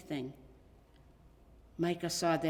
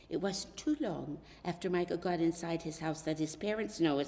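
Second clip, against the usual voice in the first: ordinary delivery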